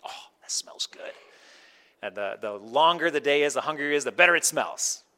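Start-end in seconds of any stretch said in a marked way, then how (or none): 0.0-1.1 breathy whisper of delight. Retold or imitated. Savoring Leanne's cooking, whispered delight. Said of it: "It's like".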